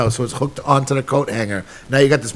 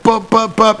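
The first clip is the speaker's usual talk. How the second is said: knocking sound effect; 9 semitones higher, slower than usual. Retold or imitated. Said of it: "We were like".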